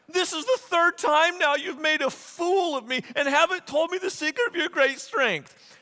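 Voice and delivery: high-pitched nagging complaint; mock indignation. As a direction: read it as a scolding in mock outrage. Reads Delilah's third complaint, acted.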